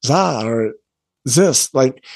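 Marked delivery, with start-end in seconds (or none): 0.0-0.4 "za" with a buzzing z in place of th; German th as z. Shown as a demonstration. Showing how Germans say "the", German z for th. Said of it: "when you hear German people say the or this". 1.3-1.7 "zis" with a buzzing z in place of th; German th as z. Shown as a demonstration. Showing how Germans say "this", German z for th. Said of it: "when you hear German people say the or this".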